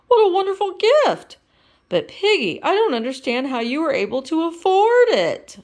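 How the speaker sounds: high, loud, thrilled frog; a grateful boyfriend, overwhelmed; Kermit voice. Acted. Kermit voice in the story.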